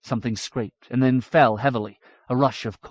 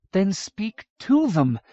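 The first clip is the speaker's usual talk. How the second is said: urging; 8 semitones higher, slower than usual. A narrator's reading. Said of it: "Miriamel urged".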